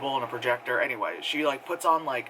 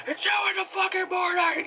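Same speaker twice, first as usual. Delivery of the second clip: very high squeaky mock yell; mock angry dad. Dad complaining at his daughter about the hour, in a squeaky mock yell. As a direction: squeak it like a parody of an annoyed dad.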